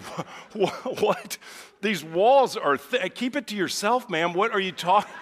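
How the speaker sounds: high, loud alarmed disbelief; mock panic, voice jumping up; panicked. Panicked reply, retold inner voice.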